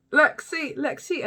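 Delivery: high, acted name call. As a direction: imitate someone calling out a name. Acting out calling Lexi's name.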